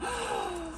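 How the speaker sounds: quick high gasp; high surprised gasp. Real, not acted. Vlogger finding the heart-shaped piece, high gasp. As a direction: gasp as a new surprise appears.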